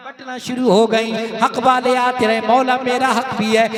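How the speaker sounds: loud ringing sermon delivery, sustained pitch; full-force preaching. Acted. Preacher warns oppressors of the day of reckoning, full-force preaching. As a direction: preach it out to the whole congregation.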